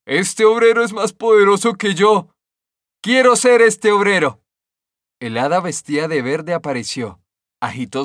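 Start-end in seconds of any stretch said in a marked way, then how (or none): 0.1-4.4 much higher, louder exclamation; stone exclaiming. A narrator's reading. The stone exclaiming about the worker, high and loud. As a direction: cry out in envy of the worker. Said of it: "La piedra exclamó".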